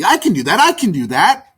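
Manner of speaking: high, eager repeated chirp; excited. Acted. Starling rushing off to build, excited. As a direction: bounce with eagerness. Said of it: "flapped her wings excitedly".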